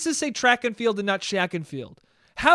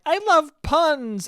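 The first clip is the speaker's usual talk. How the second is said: high, slow, flirty "I love puns"; flirtatious; 9 semitones higher, slower than usual. Retold or imitated. Mocking a date who flirts with puns, high and slow. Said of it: "in a flirtatious way are like".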